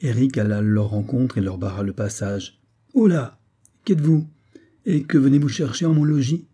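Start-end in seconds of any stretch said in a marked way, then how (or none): 2.9-6.5 louder and higher, angry demand; an officer angrily confronting soldiers over the garden wall; angry challenge. A narrator's reading. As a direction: bark at the intruders in anger. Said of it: "demanda-t-il avec colère".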